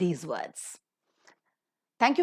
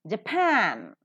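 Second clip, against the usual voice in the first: Japan with the -pan drawn out; Japan, weight on -pan; stress on second syllable. Shown as a demonstration. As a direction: lean on the last syllable of Japan. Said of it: "pa is stressed".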